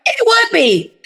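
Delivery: sudden realization. Retold or imitated. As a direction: let it leap up high, then fall, as if it just dawned on you. Retelling her delighted agreement to title.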